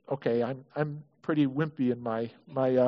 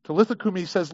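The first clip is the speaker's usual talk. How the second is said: usual speaking voice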